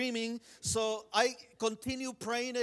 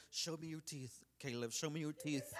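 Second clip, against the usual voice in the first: soft, lowered retold plea; hushed request; 4 semitones lower, 10 dB quieter than usual. Boy asking his hurt brother to open up, hushed. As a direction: ask it gently, well under full voice. Cautiously asks injured brother to show teeth.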